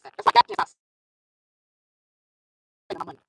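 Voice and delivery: usual speaking voice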